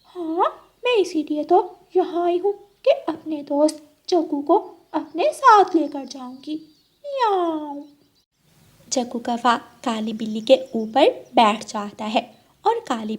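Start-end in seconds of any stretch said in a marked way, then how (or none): 0.0-7.8 high, sweet-talking cat voice with meows; a scheming cat luring her friend; high purring cat voice. Acted.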